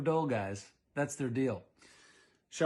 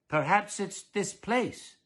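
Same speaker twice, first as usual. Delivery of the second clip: slow, higher, slightly prim musing; a touch of a fussy husband; 7 semitones higher, 4 dB louder, slower than usual. Acted. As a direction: ponder it like a dull fussy husband. Mister Dullard speaking.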